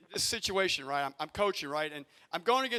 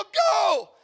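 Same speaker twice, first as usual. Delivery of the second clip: one sharp retold shout, much higher and louder; yelling go; 13 semitones higher, 11 dB louder than usual. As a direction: bark it at the runner. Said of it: "I go".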